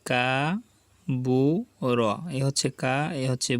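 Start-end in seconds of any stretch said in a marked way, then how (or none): 0.1-2.0 Cavour stretched very slowly, each sound held; drawn out. Shown as a demonstration. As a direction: drawl Cavour out for learners. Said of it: "teacher drawls slowly". (Cavour খুব টেনে ধীরে ধীরে)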